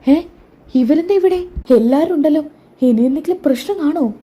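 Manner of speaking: high, startled disbelief; sudden high-pitched surprise at seeing everyone. Acted. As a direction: gasp it out, caught off guard. Sachi stunned seeing family at station.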